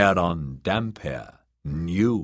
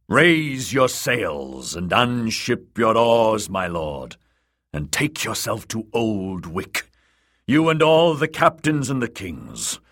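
prophet's summons; 4 semitones higher than usual. Acted. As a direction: summon them all in the god's name. Priest summons captains.

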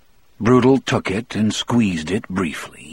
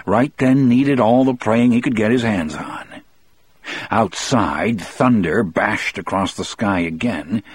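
plain reading voice

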